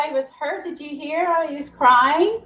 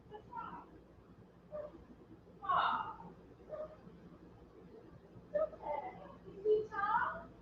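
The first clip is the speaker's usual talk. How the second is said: high soft pet talk; 11 semitones higher, 12 dB quieter than usual. Real, not acted. Comforting her crying dog Bandit in a high hushed coo.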